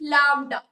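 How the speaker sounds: "lambda" pronounced carefully for learners; pronunciation demo. Shown as a demonstration. Teacher shows class how to pronounce "lambda", clear demonstration. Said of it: "Will you pronounce it?".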